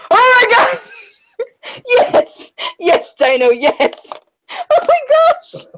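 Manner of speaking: high, laughing cries of joy; ecstatic. Real, not acted. Streamer cheering at a drawing, ecstatic. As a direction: cheer it out, near tears.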